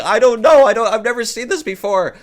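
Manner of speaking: high, loud, frantic protest; panicked denial. Retold or imitated. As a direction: blurt the excuse up high in a panic. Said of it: "And I was like".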